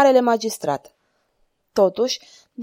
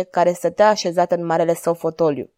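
usual speaking voice

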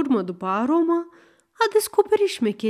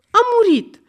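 shrill insistent cry; 12 semitones higher, 7 dB louder, faster than usual. Acted.

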